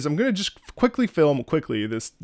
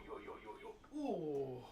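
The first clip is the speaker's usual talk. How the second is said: ordinary delivery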